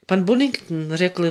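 ordinary delivery

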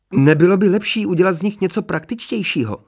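high-pitched woman's character voice; Mrs. Hermanová's voice. A narrator's reading. Interrupting the wizard from behind his back, high-voiced suggestion. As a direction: pitch it well up as a lady chiming in. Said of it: "ozval se za jeho zády hlas paní Hermanové".

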